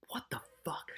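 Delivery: breathy whispered disbelief; stunned whispered aside; shocked whisper. Real, not acted. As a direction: whisper it under your breath.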